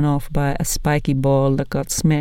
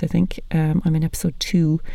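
ordinary delivery

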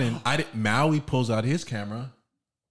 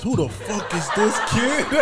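laughing mock quote; 10 semitones higher, 4 dB louder, slower than usual. Retells Flash's line laughing.

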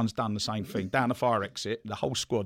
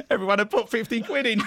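laughing; 14 semitones higher, 6 dB louder than usual. Real, not acted. Punchline of the fines story.

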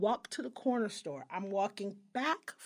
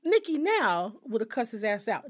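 ordinary talk

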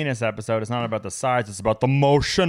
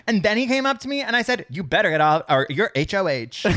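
a hint of reality TV testimonial, higher; reality show confessional; 8 semitones higher, 3 dB louder than usual. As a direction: gossip like a confessional. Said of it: "testimonial Big Brother energy".

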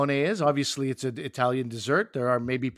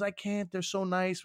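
higher, softer imitation of the daughter; imitating daughter; 4 semitones higher, 6 dB quieter than usual. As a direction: play the teenage daughter, plaintive. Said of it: "She goes".

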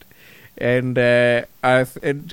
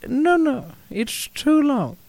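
high, drawn-out mock wife voice; wife's voice; 11 semitones higher, slower than usual. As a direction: imitate the wife, pitched way up and slow. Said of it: "it's very hard to do a voice".

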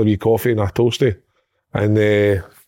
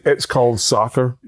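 mock American; slower than usual. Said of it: "lifted his shirt up and said".

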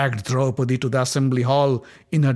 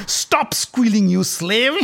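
higher, louder, slow mockery; jeering; 9 semitones higher, 5 dB louder, slower than usual. Dussasana jeers at the fallen queen, sneering. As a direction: sneer down at her.

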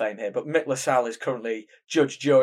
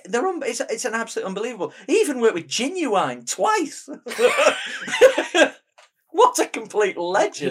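high, giddy gushing with a laugh; 8 semitones higher, 3 dB louder than usual. Real, not acted. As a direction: squeal with delight. Gushing about music video director.

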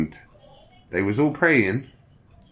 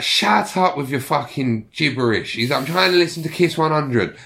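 higher, irritated mock telling-off; retold angry outburst; 6 semitones higher than usual. Mate telling praying passengers off over the radio, mock anger. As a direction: imitate a mate telling people to shut up.

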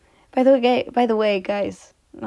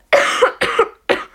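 sick cough; 9 dB louder than usual. Real, not acted. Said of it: "You can tell I'm sick".

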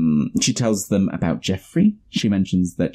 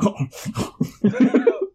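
high snorting huff laugh, mimicking the character; imitated snotty laugh. Friend mimics the man's odd laugh for the other: high snorty huff laugh. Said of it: "He's like".

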